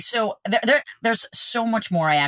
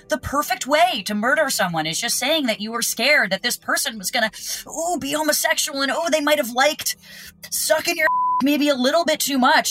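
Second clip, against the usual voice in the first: higher, rushing, half-laughing outrage; nervous rambling; 6 semitones higher than usual. Real, not acted. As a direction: spill it out, flustered and giggly. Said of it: "I am sorry to use all these phrases".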